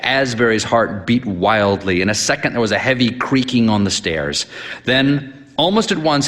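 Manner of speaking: usual speaking voice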